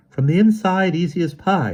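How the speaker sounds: put-on high, lively boy voice; bright young boy answering. A narrator's reading. Emerson answers confidently.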